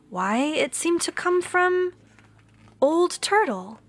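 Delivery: put-on storyteller reveals Old Turtle with wonder; surprised discovery. A narrator's reading. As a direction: pitch leaps up in wonder at why. Narrator discovers the speaker.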